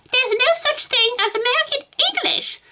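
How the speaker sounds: very high squeaky parody; mocking mimicry. A narrator's reading. Meg parroting Susan's lesson, mocking mimicry.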